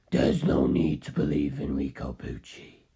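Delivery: deep low voice for Carl contradicting the detective; Carl's voice. Acted. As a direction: drop the voice far down and take it slowly.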